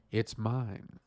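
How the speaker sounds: normal talking voice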